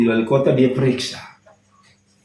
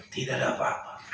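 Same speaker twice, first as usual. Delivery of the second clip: hushed relieved whisper; whispered relief; 14 dB quieter than usual. Real, not acted. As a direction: whisper it, barely voiced. Relief reading test result.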